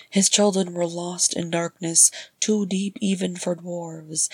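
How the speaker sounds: ordinary delivery, nothing marked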